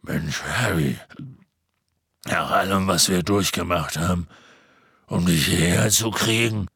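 tiefe, polternde Riesenstimme; Hagrids Stimme. A narrator's reading. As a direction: sprich wie ein großer gutmütiger Halbriese. Hagrid spricht weiter. (tiefe Stimme eines Halbriesen)

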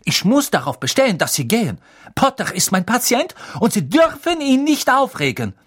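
high, loud, outraged insistence; indignant. A narrator's reading. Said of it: "sagte Madame Pomfrey empört".

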